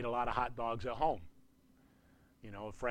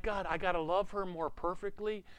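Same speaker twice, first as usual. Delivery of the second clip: higher-pitched, put-upon complaint to God; mock protest; 5 semitones higher than usual. Husband balking at God's command to love, mock protest. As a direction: question the demand in a raised pitch.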